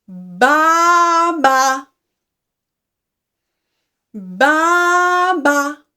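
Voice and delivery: loud double baa, first one held long; long baa, then a short baa; sheep bleat. Shown as a demonstration. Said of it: "Sheep says".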